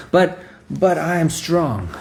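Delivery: slow, high, hammed-up boast; proud insistence. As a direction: insist on your strength with mock pride. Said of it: "proud and haughty".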